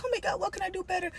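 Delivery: high, fast, fretful little voice; mock self-doubting whine. Viewer mocking her own worry after the video, fretful. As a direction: panicky self-questioning, played up. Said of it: "and be like".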